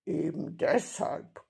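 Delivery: slow robber's growl. A narrator's reading. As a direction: growl it out slowly. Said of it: "knurrte der Räuber Hotzenplotz".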